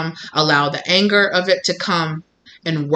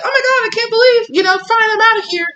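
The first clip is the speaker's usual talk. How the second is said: shrill mock outrage, much higher and louder; mock outraged storm-off; 5 dB louder than usual. Mocking the provoker who flounces off in high outrage. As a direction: act out the indignant storm-off.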